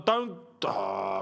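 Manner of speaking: ordinary delivery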